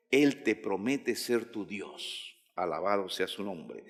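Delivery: low, soft marvelling; hushed reverence. Real, not acted. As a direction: speak the promise softly, in reverence.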